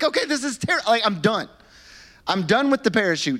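hammed-up I've-had-it complaint; mock exasperation. Passenger after a spilled drink, mock exasperated and high. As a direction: jump up high on "terrible", fed up.

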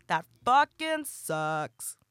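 dragged out slowly, each word weighed; slow emphatic repeat. Shown as a demonstration. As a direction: stretch it out for emphasis. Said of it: "repeated phrase".

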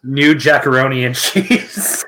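ordinary talk

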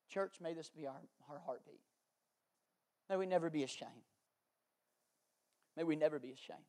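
low, soft, solemn exhortation; hushed appeal. Real, not acted. Pastor urging his church never to be ashamed, hushed appeal. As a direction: let it sink almost to a prayer, with long pauses.

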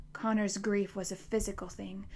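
ordinary delivery

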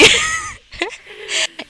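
squealing burst of laughter; high squealing laugh. Real, not acted. Laughs at own remark.

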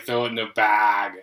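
"bag" with a long stretched A; southern triple A. Shown as a demonstration. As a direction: draw the A in "bag" out southern-style. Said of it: "the A's are pronounced like three A's".

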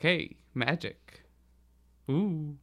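usual speaking voice

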